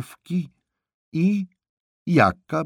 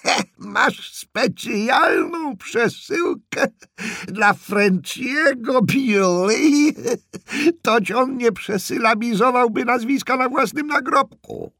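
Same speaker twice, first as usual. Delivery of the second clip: very high, loud, drawn-out screech; old woman hollering at high pitch; shrieking old aunt; 7 dB louder than usual. Acted. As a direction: screech it back with derision. Aunt shrieks back, cackling.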